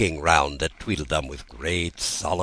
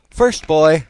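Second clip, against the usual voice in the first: higher, louder schoolroom call-out; teasing call; 10 semitones higher, 9 dB louder than usual. Acted. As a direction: point and call him out like a teacher. Alice pointing at the twins.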